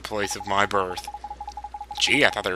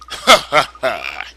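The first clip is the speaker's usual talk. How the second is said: mock hearty laugh; much slower than usual. Captain's laugh voiced.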